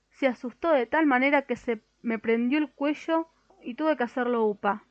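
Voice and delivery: ordinary delivery, nothing marked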